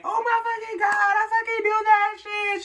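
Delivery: shrieking way up high and loud, mock-crazy; playful excited scream. Sister shrieking with joy at her brother coming out. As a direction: scream it like you're thrilled, half joking. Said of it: "She went crazy. But just playing around".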